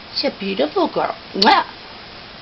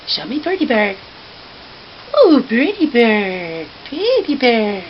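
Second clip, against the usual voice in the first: ordinary talk, nothing marked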